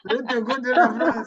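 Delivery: laughing repetition of a joke; amused echo. Real, not acted. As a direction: repeat it through laughter. Listener echoes the joke, laughing.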